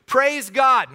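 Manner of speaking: crowd shouting. Acted. People around Jesus praising him in procession, shouting. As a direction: call it out over the crowd. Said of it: "the people all around him were shouting".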